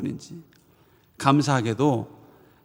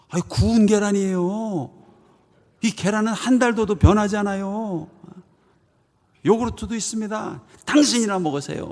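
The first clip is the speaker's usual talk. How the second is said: higher, bright-toned teasing sales pitch; playful higher-pitched retort; cheerful comeback; 7 semitones higher than usual. Retold or imitated. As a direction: sweetly hand the brush-off right back. Preacher's cheerful comeback retold.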